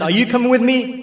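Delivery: high, loud retold question; retold rallying call. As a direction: call it out as if summoning followers. Retold call to each person.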